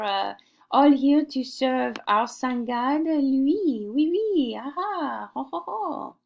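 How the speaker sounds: put-on lightly French-accented courtier gushing over Louis; mock French aristocrat. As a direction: ham up a simpering French noble. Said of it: "the fancy French aristocracy, could be like".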